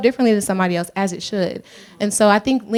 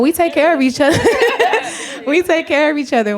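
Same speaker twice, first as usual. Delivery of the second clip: laughing, louder and higher on it; laughing proudly; 8 semitones higher, 3 dB louder than usual. Real, not acted. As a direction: laugh it out with pride.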